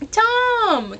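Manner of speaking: high, drawn-out adoring cry; fangirl swoon. Retold or imitated. Fan moving toward the favorite band member, adoring swoon. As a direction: sing out the name like a smitten fan.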